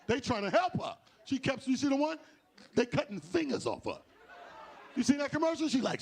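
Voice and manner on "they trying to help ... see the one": ordinary talk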